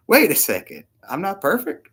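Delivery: hammed-up indignant surprise; mock disbelief. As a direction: play the shocked listener questioning it. Listener objecting in mock disbelief.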